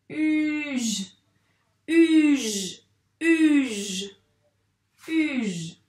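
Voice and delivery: uge loud, long U falling into held "zh"; blended syllable. Shown as a demonstration. Teacher drilling the syllable with a reader, uge loud and drawn out. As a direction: stretch uge out and repeat it evenly. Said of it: "On va faire le son de ces deux lettres".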